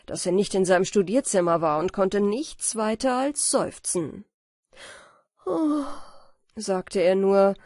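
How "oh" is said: a high, woeful sigh of an oh. Acted. Dazed scholar sighs to the king, woeful oh. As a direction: let the oh carry great misery. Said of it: "es lag ein großer Jammer darin".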